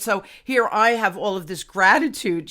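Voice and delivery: ordinary talk, nothing marked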